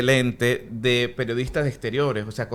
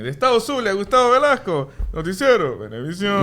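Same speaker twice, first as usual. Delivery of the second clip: high, loud sing-song TV sign-off; imitating a Maracaibo news correspondent signing off; Gustavo Velasco sign-off; 7 semitones higher, 5 dB louder than usual. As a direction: chant the Zulia reporter's sign-off. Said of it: "escuché de Gustavo Velasco".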